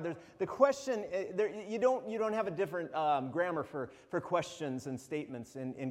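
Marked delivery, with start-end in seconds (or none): none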